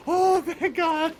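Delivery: high, drawn-out cry of relief with a laugh; laughing, hammed-up relief; exaggerated relief. Retold relief at friend's admission.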